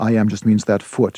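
ordinary talk

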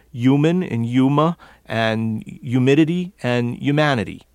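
New York human and humidity without the H; New York "yuman, yumidity"; New York accent. Shown as a demonstration. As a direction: drop the H as New Yorkers do, starting on Y. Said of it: "In this area, that H disappears".

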